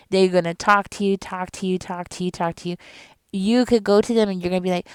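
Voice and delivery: usual speaking voice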